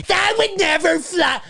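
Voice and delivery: high, sneering mock doubter; mocking skeptic. Host mimicking doubting listeners, high mocking whine. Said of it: "I know what you're thinking".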